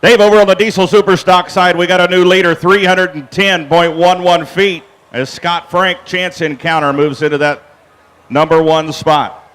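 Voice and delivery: loud, fast PA call. Real, not acted. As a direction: call the new leader across the grounds. Calling out the new leader.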